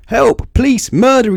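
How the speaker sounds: very high, very loud cries for help; full-pitch storybook hysteria; terrified screaming. A narrator's reading. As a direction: scream each word for your life. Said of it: "he screamed".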